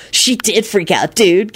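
slow, teasing dude drawl; lightly voiced gleeful kid. A narrator's reading. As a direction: report bad news with relish. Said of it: "Kenny responded gleefully".

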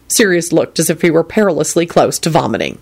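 usual speaking voice

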